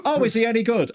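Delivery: high, laughing mock builder; other builder asking. Second builder asks if the comedian is good, high laughing voice. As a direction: ask it through a laugh, high and light. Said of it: "This other guy goes".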